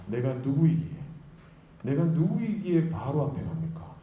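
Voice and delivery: acted protest, pitch climbing on the repeat; incredulous protest. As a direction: push back in mounting disbelief. Moses protesting God's command, acted. (믿기지 않는다는 듯 항변하며)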